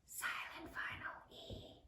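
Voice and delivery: very soft whisper for the silent letter. Shown as a demonstration. Teacher writes along with the class, whispering the silent letter.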